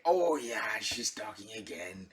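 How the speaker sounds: low, soft resigned groan of a line; weary husband. Acted. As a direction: let it sink away, fed up with hearing her. Husband weary of wife's nagging.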